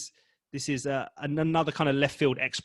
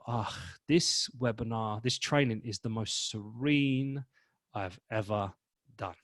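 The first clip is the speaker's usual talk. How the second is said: slow, lower and softer, calm; serene; 4 semitones lower, 5 dB quieter, slower than usual. Shown as a demonstration. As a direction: float it out peacefully. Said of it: "Serene, you feel that".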